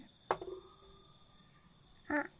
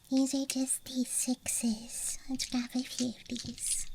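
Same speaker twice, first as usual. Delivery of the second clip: ASMR whisper. Real, not acted. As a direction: whisper close to the mic. ASMR dice show-and-tell.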